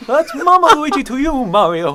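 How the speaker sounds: loud, very high cartoon voice; hammed-up high cartoon impression, crying out proudly; Luigi. Asked for his best impression, high cartoon voice. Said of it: "I'm gonna say my Luigi impression".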